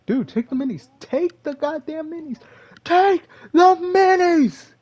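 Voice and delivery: building to a very high, loud yell; mad at himself. Retold or imitated. Gamer scolding himself on the footage, building to a high yell. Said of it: "I got really mad at myself".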